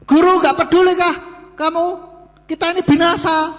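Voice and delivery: high, panicky reproach to the teacher; frightened disciple accusing Jesus of not caring; panicked complaint. Retold or imitated. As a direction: blurt it out in fear, voice up high. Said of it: "terlalu diliputi rasa takut".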